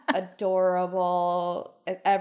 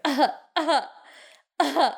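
imitated fake cough; 5 semitones higher, 4 dB louder than usual. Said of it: "he's like".

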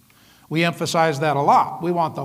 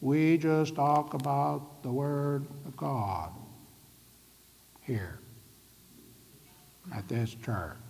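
slow and much softer, fading to a whisper on here; hushed; 4 semitones lower, 11 dB quieter, slower than usual. Shown as a demonstration. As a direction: say it like a secret only this church keeps. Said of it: "it should be more like this".

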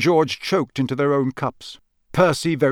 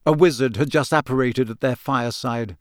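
ordinary delivery

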